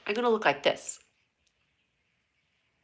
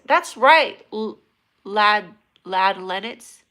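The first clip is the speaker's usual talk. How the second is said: loud hype agreement; 9 dB louder, much slower than usual. Pretend. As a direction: cheer it like a hype man. Acting as the TikTok guy.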